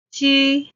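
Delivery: 机 held high and level, first tone. Shown as a demonstration. Teacher modelling for learners, high level 机. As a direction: sing 机 on one high flat pitch. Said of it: "the first tone".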